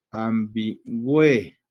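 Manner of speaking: "Ambigüe" slowly in syllables, swelling on the E; drawn-out rising diphthong. Shown as a demonstration. Teacher showing the diphthong, slow and emphatic. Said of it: "El sonido se, se incrementa en la E".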